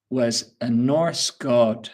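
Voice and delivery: was a Norse god said slowly; deliberate teacher pace; slow emphasis. Shown as a demonstration. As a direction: spell it out slowly for the class.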